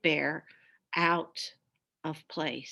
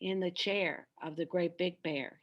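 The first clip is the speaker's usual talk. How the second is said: ordinary delivery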